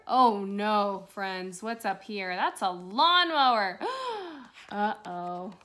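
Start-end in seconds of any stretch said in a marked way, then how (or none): none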